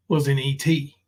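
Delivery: ordinary talk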